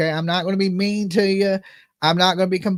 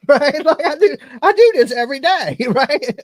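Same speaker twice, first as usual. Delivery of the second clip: laughing; 6 semitones higher, 7 dB louder than usual. Real, not acted. Laughing at the claim.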